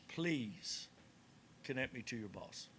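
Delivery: soft, low request with measured pauses; quiet, restrained insistence. Retold or imitated. Caller repeating a request to an agent, quietly insistent. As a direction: hold back frustration, pausing after "Please".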